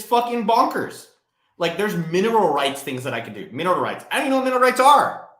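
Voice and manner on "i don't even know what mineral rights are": fast, high and loud disbelief; incredulous rant. Real, not acted. Venting to viewers about tax loopholes, incredulous. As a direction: blurt it out in disbelief.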